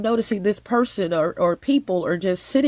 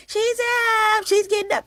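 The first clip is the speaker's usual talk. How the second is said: exaggerated high-pitched imitation of an announcer; mock announcing; 14 semitones higher than usual. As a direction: call it out like a lookout, way up high. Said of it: "just announcing".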